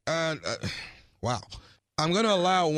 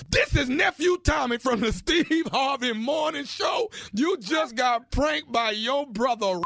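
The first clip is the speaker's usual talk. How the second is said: triumphant reveal shout; 8 semitones higher, slower than usual. Acted. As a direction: spell out the prank reveal, shouting. Prank reveal, announcing himself.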